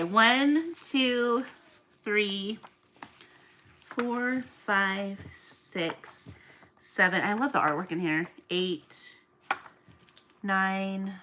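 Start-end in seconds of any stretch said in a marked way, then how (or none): none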